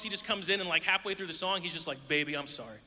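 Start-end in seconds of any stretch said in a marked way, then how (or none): 2.1-2.8 deep-voiced backup singer. Low bass crooner slipping in mid-song. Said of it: "he just has a really deep voice".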